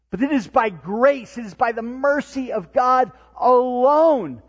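loud, high, emphatic sermon climax; full-force preaching. Real, not acted. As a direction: drive it home to the congregation with ringing force.

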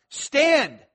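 one loud, high imperative; yelled command. Acted. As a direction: bark it with an exclamation point. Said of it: "he's yelling at them".

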